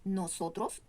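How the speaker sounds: "Nosotros" with each o fully sounded; clear O. Shown as a demonstration. Teacher modelling for learners, clear o's in "Nosotros". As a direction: say "Nosotros" carefully with pure o vowels. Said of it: "On prononce bien la O".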